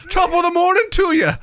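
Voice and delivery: high, hammed-up attempt at Scottish; shaky-grasp mock Scottish accent. Shown as a demonstration. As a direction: have a rough go at a Scottish accent.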